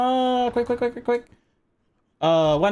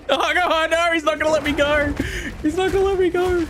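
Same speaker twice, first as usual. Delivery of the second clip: frantic panic; 13 semitones higher than usual. Real, not acted. Streamer panicking as the boss traps her. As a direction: plead in panic to escape.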